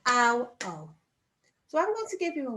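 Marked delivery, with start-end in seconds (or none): 0.1-0.9 ow then O, each vowel drawn out clearly; modelling the two sounds. Shown as a demonstration. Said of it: "And again".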